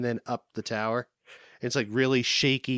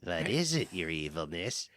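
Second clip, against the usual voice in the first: low, quiet groveling; mock lackey voice; low mock-servile lackey; 6 semitones lower, 7 dB quieter than usual. Mock courtly address.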